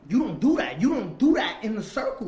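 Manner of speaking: loud, high, emphatic scolding; enraged. Retold or imitated. Man confronting girlfriend over betrayal, enraged. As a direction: relive the outrage, hammering each phrase. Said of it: "I went into a rage".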